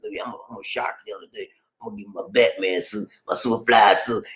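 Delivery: low, soft, quick put-on voice; imitating a friend. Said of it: "He said".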